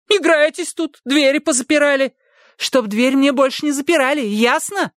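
a bossy housekeeper demanding entry; angry maid shouting. A narrator's reading.

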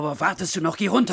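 loud, high barked command; shouted order to leave at once; angry order. Acted. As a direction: snap him out of the room. Orders Pierre out angrily.

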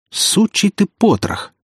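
high, slow, furious curse. Acted. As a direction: spit the insult out with rage. Prince cursing the traitor.

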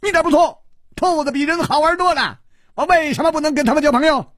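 high, silly madman voice; childish madman. Acted. Xue playing mad with Chu, high childish voice. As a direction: play the giddy fool.

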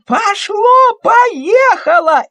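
high, loud, gleeful cry; excited little girl shout. Acted. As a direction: cheer like a thrilled child. Girl cheering paint flows.

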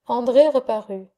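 plain reading voice

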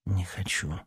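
soft, hesitant concession; reluctant answer. A narrator's reading. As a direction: give in quietly after a pause. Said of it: "немного подумав".

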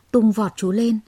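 ordinary delivery

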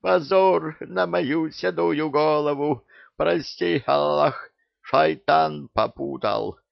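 high, loud, slow wailing lament; anguished lament. A narrator's reading. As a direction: wail in pious shame. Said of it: "схватился за голову благочестивый визирь".

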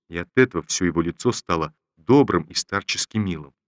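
ordinary delivery, nothing marked

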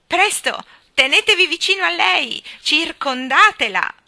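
loud, high shouted order; urgent command. Acted. Great-grandfather ordering the children to surround Jane, shouting. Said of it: "il breve comando del bisnonno".

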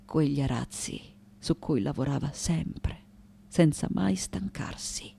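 low, soft, near whisper; hushed remembrance. Acted. Daughter remembering her tirelessly working mother, hushed remembrance.

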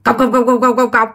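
quick, even run of knocking syllables; imitated clog footsteps. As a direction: rattle off the clack of running clogs. Imitates clogs running upstairs. (nhại tiếng guốc cộc cộc dồn dập)

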